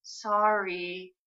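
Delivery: soft, drawn-out near-silent word; mouthed apology. A narrator's reading. Bess mouths an apology to Nancy, very softly. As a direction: barely voice it, as if mouthing. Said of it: "Bess mouths the word".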